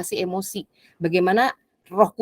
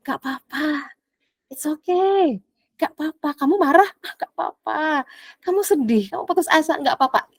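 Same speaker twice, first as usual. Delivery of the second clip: mock soothing; 4 semitones higher than usual. Mocking the world's soothing voice.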